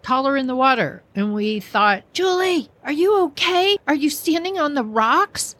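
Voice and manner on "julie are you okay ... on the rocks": worried calling. Retold or imitated. Calling down to Julie in water.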